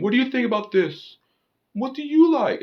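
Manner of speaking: put-on high-pitched parody of a needy boyfriend; mock approval-seeking. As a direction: ask in falsetto, mocking someone eager to please. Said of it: "seeking her approval and everything".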